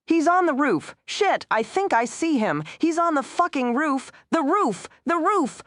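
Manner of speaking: high, panicked radio yell; panicked shouting. Acted. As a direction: cry out in panic.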